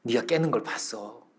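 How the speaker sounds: low, hushed, slow admission; quiet elder confiding; kindly master. Retold or imitated. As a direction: gently reveal it in a soft low voice. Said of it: "그 주인 어른이 이렇게 말을 하는 거예요".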